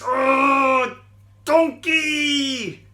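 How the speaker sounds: high, loud angry yell at the donkey; angry shout. Acted. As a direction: bellow it out in a rage. Balaam angrily shouts at donkey.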